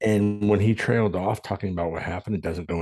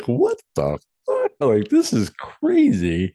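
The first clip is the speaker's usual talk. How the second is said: disbelief; 2 semitones higher, slower than usual. Retold or imitated. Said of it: "And I'm like".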